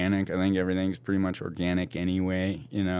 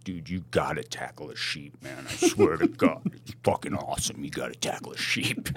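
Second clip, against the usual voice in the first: lower, hushed buddy egging him on; buddy's voice; 5 semitones lower, 3 dB quieter than usual. Retold or imitated. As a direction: imitate a pal urging you, low and conspiratorial, ending in a whisper. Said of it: "He's like".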